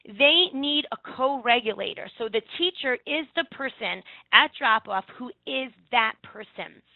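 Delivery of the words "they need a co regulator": usual speaking voice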